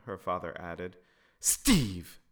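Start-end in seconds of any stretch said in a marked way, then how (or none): 1.4-2.2 loud scolding call of a name; loud reproachful name call; chastising. A narrator's reading. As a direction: scold your husband sharply. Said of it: "Caroline chastised".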